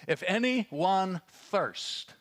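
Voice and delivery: high, very slow carrying call; projected cry. Retold or imitated. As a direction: stretch it out, calling to the crowd. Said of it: "cried out means with a projected voice".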